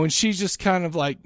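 normal talking voice

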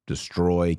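ordinary talk, nothing marked

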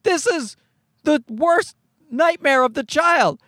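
high, aghast exclamation; hammed-up disbelief; incredulous outburst. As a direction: protest in high-pitched mock horror. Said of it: "I'm like".

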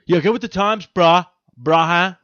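loud, high surfer bro. Pretend. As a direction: ham up a dude-bro. Bro voice teasing friend.

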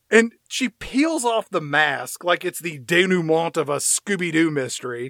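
usual speaking voice

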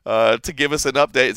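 ordinary talk, nothing marked

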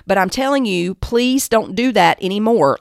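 normal talking voice

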